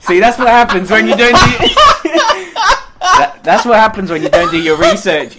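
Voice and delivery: loud, high, through real laughter; laughing scolding. Real, not acted. Co-host chiding the host's lack of research, laughing hard. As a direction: tease them while cracking up.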